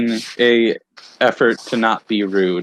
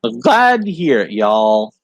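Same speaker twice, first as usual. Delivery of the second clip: put-on Southern accent. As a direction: play a folksy Southern right-hand man. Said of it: "I'm gonna stick with Southern".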